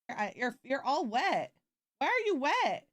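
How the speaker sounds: rising to a high squeak; puzzled coo at the dog; pet talk. Real, not acted. Talking to wet dog.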